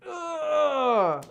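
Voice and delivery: regretful groan. Retold or imitated. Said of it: "And you're like".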